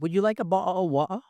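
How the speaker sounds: mock Cockney waiter offering water; Cockney accent. Shown as a demonstration. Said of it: "that's actually Cockney".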